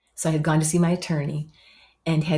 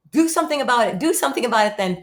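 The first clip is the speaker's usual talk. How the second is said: higher, louder, fast comeback; defiant retort; 7 semitones higher, 4 dB louder, faster than usual. Retold or imitated. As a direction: throw it back at them. Defiant reply to friends.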